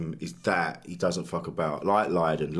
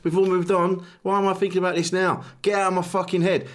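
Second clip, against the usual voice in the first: pleading, exasperated; 9 semitones higher than usual. Retold or imitated. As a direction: beg your mind to shut up. Pleading with own brain.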